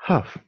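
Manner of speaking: Half said as haf, no L sound at all; L silent in half. Shown as a demonstration. Teacher models Half for French pupils, L silent. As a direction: drop the L: Half rhymes with laugh. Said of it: "la L ne se prononce pas".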